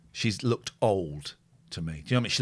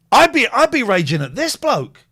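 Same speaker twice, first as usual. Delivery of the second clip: loud rant; 11 semitones higher, 9 dB louder than usual. Real, not acted. Angry viewer rant.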